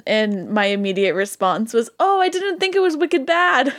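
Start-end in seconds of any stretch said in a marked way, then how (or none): 2.0-3.7 high-pitched New England exclamation; blurted New England twang; New England accent. Retold or imitated. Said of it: "such a thick New England accent".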